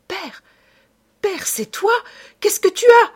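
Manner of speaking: high, loud, frantic call to her father; panicked cry. A narrator's reading. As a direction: scream for father in terror. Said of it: "s'écria-t-elle affolée".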